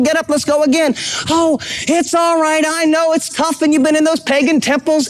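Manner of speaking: high, eager cheering father, pitch well up; a dad urging his fallen son back up; encouraging dad. Retold or imitated. Pastor voicing a loving dad cheering his son on, high and eager. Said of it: "We were the dad who said".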